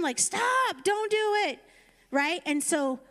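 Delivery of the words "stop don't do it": high urgent call to stop; angel yelling. Retold or imitated. Angel calling down to Abraham to stop, urgent high yell. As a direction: cry out high to halt the knife. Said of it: "like, yelling at him".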